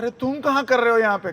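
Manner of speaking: acted disbelief at who is there; चौंका सवाल. पति को देख चौंकी गोपी. (चौंककर ज़ोर से पूछना)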